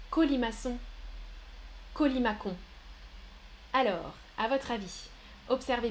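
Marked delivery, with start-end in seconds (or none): 1.9-2.6 Colimacon with a hard K before the final on, kolimakon. Shown as a demonstration. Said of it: "Je répète". (Colimacon prononcé avec un K, colimakon)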